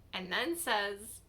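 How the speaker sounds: ordinary talk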